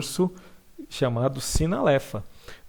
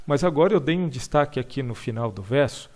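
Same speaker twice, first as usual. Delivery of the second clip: ordinary talk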